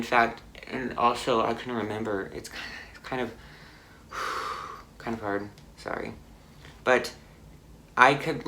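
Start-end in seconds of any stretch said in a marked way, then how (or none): 2.3-6.1 quieter, breaking off with a sigh; struggling to talk about it. Real, not acted. Woman retelling a car crash, struggling to get it out. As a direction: falter softly, stopping to sigh. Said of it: "It's kind of hard to talk about".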